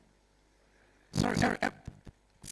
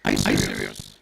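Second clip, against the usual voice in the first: mock disbelief; 11 dB louder than usual. MC feigning disbelief at crowd.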